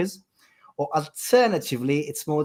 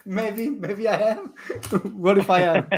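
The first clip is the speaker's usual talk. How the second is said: laughing; 5 semitones higher, slower than usual. Real, not acted. Caught out, coy laughing admission.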